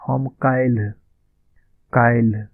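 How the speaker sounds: काल्हि said loud and clearly, as kaal-hi; काल्हि spoken loud and distinct; clear pronunciation. Shown as a demonstration. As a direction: stress काल्हि so students catch it. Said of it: "यहाँ पे इसका उच्चारण देख लीजिए".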